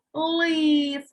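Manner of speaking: лис with a long, loud «и»; stressed vowel. Shown as a demonstration. Teacher names the root word, лис with drawn-out «и». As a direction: hold the «и» in лис. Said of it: "під наголосом ставимо".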